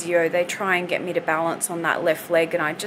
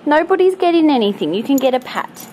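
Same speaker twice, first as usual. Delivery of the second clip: fast, high and loud playful scolding; teasing pet talk; 6 semitones higher, 7 dB louder than usual. Real, not acted. Owner teasing her begging dogs, high and playful. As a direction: tease the beggars.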